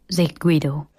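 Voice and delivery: ordinary delivery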